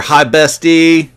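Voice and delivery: loud, high, drawn-out hello; gleeful greeting. Real, not acted. Streamer spots bestie Colleen in chat, greeting her excitedly. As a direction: sing it out to a best friend.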